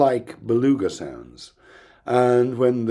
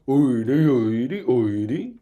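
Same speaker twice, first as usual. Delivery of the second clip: "oidi, oidi, oidi" as separate syllable groups, each rising; divided into syllables; slower than usual. Shown as a demonstration. Phonetician shows the whale's syllables, "oidi" in rising segmented beats. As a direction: chant "oidi" three times in evenly segmented beats. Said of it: "they're divided up into syllables".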